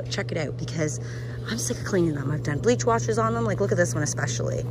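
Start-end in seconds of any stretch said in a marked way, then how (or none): none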